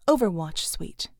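usual speaking voice

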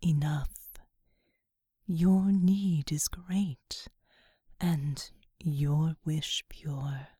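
low breathy whisper of a vast angel; whispering angelic being. Acted. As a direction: whisper low and otherworldly, slowly. Seraphim speaks.

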